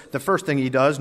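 ordinary talk

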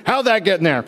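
hammed-up feigned surprise; a playful mimic of the man; mock innocent. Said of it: "I mean, he just took it".

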